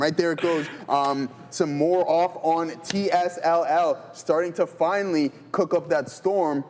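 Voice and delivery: usual speaking voice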